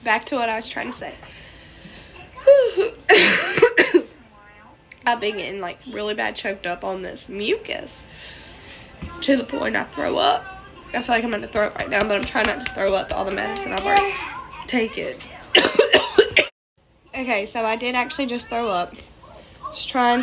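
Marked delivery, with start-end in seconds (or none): 2.4-4.0 a real cough that breaks into a small laugh; cough, then laugh it off. Real, not acted. 15.2-16.5 a real, choking, gagging cough. Real, not acted. Coughs, then throws up.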